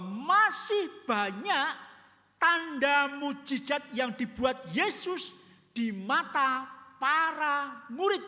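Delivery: preacher declaring John's words to the church; full-force preaching. Real, not acted. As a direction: proclaim it like a sermon climax. Preacher proclaims many miracles.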